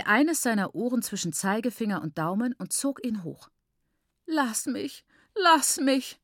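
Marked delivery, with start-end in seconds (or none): 4.3-6.2 pained, rising, high-pitched begging; groaning plea. A narrator's reading. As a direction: whine while pulled up by the ears. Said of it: "stöhnte er".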